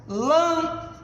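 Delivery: "lâmpada" with a high, held "lâm", long gap, then "pada"; stress on LÂM. Shown as a demonstration. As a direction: isolate the first syllable of "lâmpada" high, pause, finish. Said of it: "a antepenúltima sílaba, exemplo".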